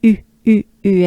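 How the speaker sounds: "U" short, with nearly closed mouth; French u sound. Shown as a demonstration. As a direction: droop the jaw into French "U". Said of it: "you pretend you're droopy".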